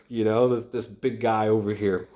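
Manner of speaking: ordinary talk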